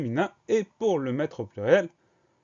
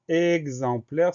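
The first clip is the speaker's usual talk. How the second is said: slow, higher and louder announcement; drawn-out dictation word; 5 semitones higher, 6 dB louder, much slower than usual. Shown as a demonstration. Teacher presenting the next word to pupils, slowly drawn out. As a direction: stretch each syllable firmly for learners.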